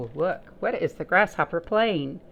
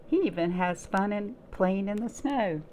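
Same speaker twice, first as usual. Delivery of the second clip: plain reading voice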